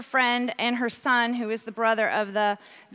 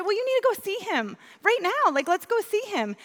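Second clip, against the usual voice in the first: much higher, eager retold urging; urgent insistence; 10 semitones higher than usual. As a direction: push them to act at once. Retold urging to visit son.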